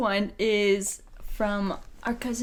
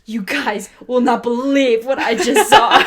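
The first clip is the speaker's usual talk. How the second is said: put-on loud, rising excited kid's outburst; excited announcement; 3 semitones higher, 11 dB louder than usual. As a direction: burst out with it, building to laughter. Retelling kid's breathless announcement.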